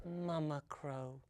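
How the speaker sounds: very soft, low naming; sweet and tender. A narrator's reading. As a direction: say the name as a hushed endearment. Tender naming of mother crow.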